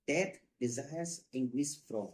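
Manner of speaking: drawn out word by word; slow rubric dictation. Shown as a demonstration. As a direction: read it out for students to copy. Teacher repeats the rubric name.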